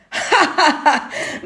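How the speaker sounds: loud wolfish guffaw; mocking laugh. A narrator's reading. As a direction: laugh at him as the wolf. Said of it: "zombou o lobo mau entre gargalhadas".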